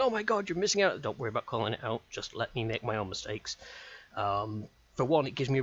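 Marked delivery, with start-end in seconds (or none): 0.0-1.0 higher, louder mock alarm; imitating a viewer. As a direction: play an excited viewer shouting a hint. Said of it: "you think".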